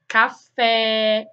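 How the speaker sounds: café with a stressed open É. Shown as a demonstration. Teacher showing students the open E in café. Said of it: "ó o E, ó".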